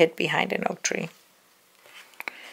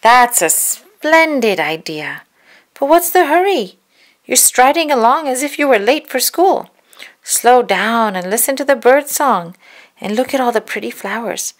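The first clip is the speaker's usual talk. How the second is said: higher, louder, sugary wolf; wolf tempting the girl to dawdle; coaxing wolf; 5 semitones higher, 6 dB louder than usual. Acted. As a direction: lure her off the path sweetly.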